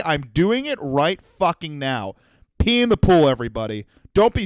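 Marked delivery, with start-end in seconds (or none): none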